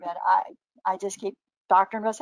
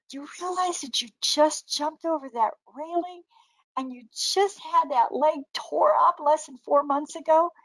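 high-pitched, emphatic disbelief; retold incredulity; 7 semitones higher than usual. Asking injured sister about jumping the railing, retold incredulous. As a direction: act out pointing out the impossible. Confronting sister after her jump.

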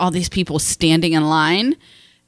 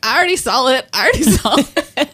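higher, sing-song gloat; mock bragging; 4 semitones higher than usual. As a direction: tease them with a playful boast. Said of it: "be like".

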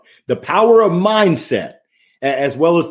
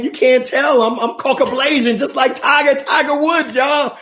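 mock proud claim; 9 semitones higher than usual. Joking he looks like Tiger.

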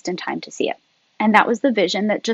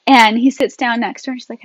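ordinary talk, nothing marked

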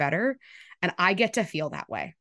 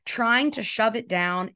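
ordinary talk, nothing marked

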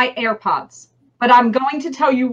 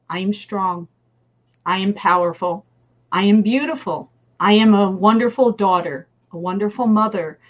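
calm affirmation; 5 semitones lower, 3 dB quieter than usual. Retold or imitated. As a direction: lower and softer, calm and sincere. Reciting her morning affirmations.

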